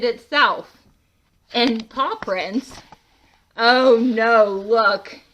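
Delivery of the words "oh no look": laughing dismay. Real, not acted. Reader laughs at the mess.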